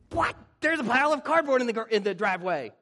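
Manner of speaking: high, fast exasperated outburst; hammed-up disbelief at the cardboard; mock freak-out. Pastor finding the cardboard still there, high mock panic. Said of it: "I freak out in situations".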